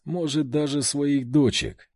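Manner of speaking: usual speaking voice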